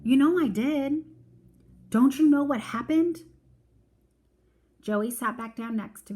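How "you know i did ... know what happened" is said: put-on higher, louder girl voice; a little girl near panic; little sister insisting she went to church; upset little sister. A narrator's reading. Joey voiced in the story.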